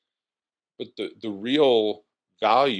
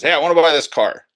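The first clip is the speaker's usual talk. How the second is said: eager customer; 7 semitones higher, 10 dB louder than usual. Retold or imitated. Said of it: "but he says".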